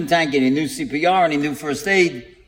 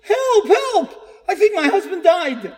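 very high-pitched cry for help, acted out; imitated yelling; 13 semitones higher, slower than usual. Old woman at her front window to the street, imitated yelling. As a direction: mimic a frantic woman calling out. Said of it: "and she's yelling".

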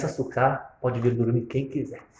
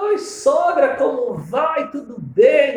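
much higher, bright sing-song hello; high cheery phone hello; overly cheery greeting; 12 semitones higher, 8 dB louder, much slower than usual. Retold or imitated. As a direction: greet the mother-in-law sweetly. Acts cheerful greeting to mother-in-law.

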